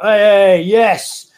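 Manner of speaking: a burst of loud, bright cheer from a British lad; loud cheery British geezer. Retold or imitated. Talksport geezer greets the interviewer with a loud cheer. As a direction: belt out the greeting like one of the boys. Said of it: "he was like".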